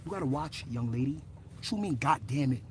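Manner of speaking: usual speaking voice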